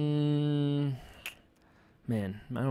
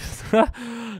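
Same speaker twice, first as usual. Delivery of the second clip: giggle; 12 semitones higher, 4 dB louder than usual. Real, not acted. Laughing at shaking desk.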